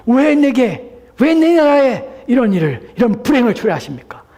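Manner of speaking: high, loud cry of grievance; resentful complaint. Acted. Pharaoh blaming God for his disasters, loud resentful outcry. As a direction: wail out the blame, drawing the words out. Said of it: "불평과 원망을".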